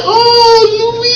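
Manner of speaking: high, wailing cry of the name; crying Oh Louise. Retold or imitated. Comic telling about the lady and her dog, crying. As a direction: wail her name, moved to tears. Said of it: "she said".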